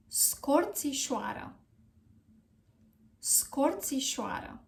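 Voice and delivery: Scorțișoară slowly, with ts and sh distinct; careful model pronunciation. Shown as a demonstration. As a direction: slowly model Scorțișoară as skor-tsee-SHWA-ruh. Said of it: "How are we going to pronounce this word?".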